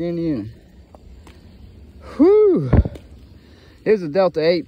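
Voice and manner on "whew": high loud whew blown out. Real, not acted. After ranting about the weed pen, loud whew. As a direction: let the rant go with a big whew. Blowing off steam after the rant.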